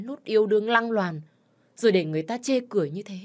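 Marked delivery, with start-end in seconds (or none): none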